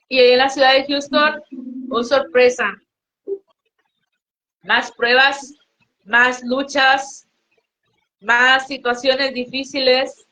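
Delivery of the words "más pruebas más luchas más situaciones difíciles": slow emphatic listing. Real, not acted. Testimony listing hardships, slowed.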